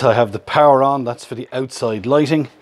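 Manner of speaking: normal talking voice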